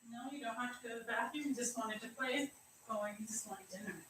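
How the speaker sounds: very soft, fast murmur; murmuring to a pet. Real, not acted. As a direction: mutter it quietly to the dog. Murmuring to the dog.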